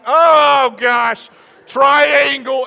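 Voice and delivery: loud, high mock alarm; comic panic. Student spotting geometry in the formula, comic panic. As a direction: cry out in hammed-up dismay.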